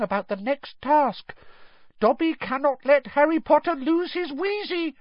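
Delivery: very high squeaky elf voice; high-pitched devoted house elf; earnest elf. A narrator's reading. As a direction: explain earnestly and insistently. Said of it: "said the elf earnestly".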